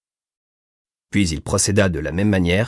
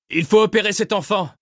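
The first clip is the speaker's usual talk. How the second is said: raised urgent voice; man down shout; 12 semitones higher than usual. A narrator's reading. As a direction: shout it over the rotor blades. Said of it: "cria le médecin".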